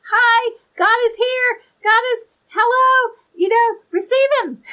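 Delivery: high, loud, bright-voiced announcing, like a friendly doorstep greeter; cheery prophet greeting. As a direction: pitch it way up and call out hello. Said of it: "the prophets are the ones to say".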